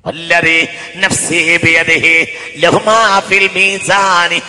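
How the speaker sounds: loud chanted sermon with drawn-out endings; sing-song preaching. Acted. Preacher reciting scripture to the congregation, chanted preaching. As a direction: preach it out, stretching the last syllables.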